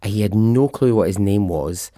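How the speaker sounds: normal talking voice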